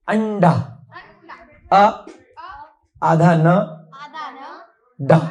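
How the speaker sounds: अंडा split as अ, आधा न, डा with pauses; syllable by syllable. Shown as a demonstration. Teacher breaking अंडा into sounds for pupils. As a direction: spell out the sounds one by one. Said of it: "ध्यान दो".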